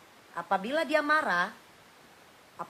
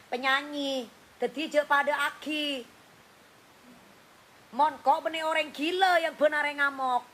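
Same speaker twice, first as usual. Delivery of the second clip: put-on mild Madurese accent, lightly raised pitch; Madurese speech; 3 semitones higher than usual. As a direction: rant like Mami in Madurese. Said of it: "Mami ngomong bahasa Madura".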